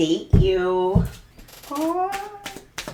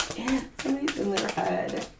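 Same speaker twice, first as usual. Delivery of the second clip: ordinary delivery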